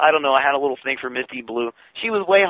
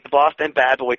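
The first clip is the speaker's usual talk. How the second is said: normal talking voice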